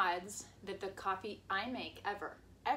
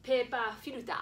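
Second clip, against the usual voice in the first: "Paper filter" with Japanese vowels and syllables, slightly higher; Japanese pronunciation; 4 semitones higher, 7 dB louder than usual. Shown as a demonstration. Wife answering a request to say it in Japanese, Japanese pronunciation. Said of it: "Say it in Japanese".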